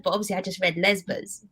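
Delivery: ordinary talk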